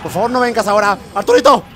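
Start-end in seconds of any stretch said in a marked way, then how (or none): 1.1-1.6 llamar fuerte a Arturito; llamando a gritos. Real, not acted. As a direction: gritar el nombre con miedo. Llama al monstruo. (llamando a gritos)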